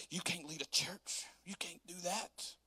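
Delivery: slow, hushed disapproval; doubting critic. Acted.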